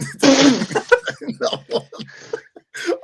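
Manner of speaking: big squealing burst of laughter; loud high laugh. Real, not acted. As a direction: crack up loudly at the joke. Big laugh at the Vinny joke.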